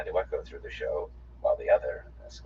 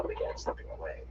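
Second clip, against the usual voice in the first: plain reading voice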